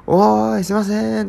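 loud, high hail through a door; desperate calling. Retold or imitated. Visitor hailing the resident from outside, desperate call. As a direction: holler imploringly, holding the vowels. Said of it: "必死にこう訴えかけてる".

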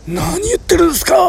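high, loud mock protest as the woman; emphatic refusal. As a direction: play up the woman brushing off the offer. Woman refuses the offered seat. (女性をまねた高く大きな大げさな拒否)

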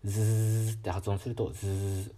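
ズー as a long buzzing z; voiced zzz. Shown as a demonstration. Teacher showing a voiced ズー to feel the throat buzz. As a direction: hold ズー buzzing the vocal cords.

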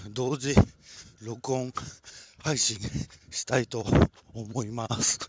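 normal talking voice

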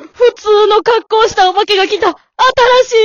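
high, loud startled exclamation; excited alarm. Acted. Wife spotting the plainly dressed ghost, startled. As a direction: blurt it out in shock.

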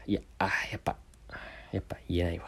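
hushed, burdened whisper. Shown as a demonstration. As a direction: whisper the refusal, heavy-hearted. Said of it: "ささやき声で". (ささやき声で言えない)

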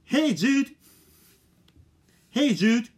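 high, loud call; loud high call to the phone. Shown as a demonstration. Testing wake word.

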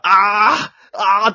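loud, high cries of dismay; dismayed commentator groan. Retold or imitated. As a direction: wail in dismay as the runner fades. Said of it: "落胆の声出しおるやん". (解説者の落胆の叫び)

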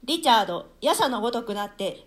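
ordinary talk, nothing marked

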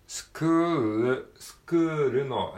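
pronunciation drill; L in school. Shown as a demonstration. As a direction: end スクール on a light L. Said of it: "ルの部分がLですね".

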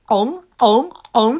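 loud, high moan from the back of the throat; open-throated moan. Retold or imitated. Comedian opening his throat for the moans, loud open wail. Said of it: "喉の奥を開きます".

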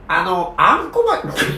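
choking on a laugh; coughing, choked up mid-laugh. Real, not acted. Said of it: "むせちゃった".

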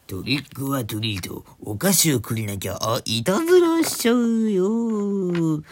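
drawn-out kabuki-style cry, rising high and loud; kabuki actor. Shown as a demonstration. Host playfully trying a Halloween trick-or-treat line, kabuki-like drawl. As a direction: stretch it out like a stage kabuki actor. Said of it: "歌舞伎役者みたいな".

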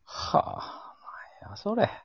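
whispered stunned what; half-whispered disbelief; breathy disbelief. Real, not acted.

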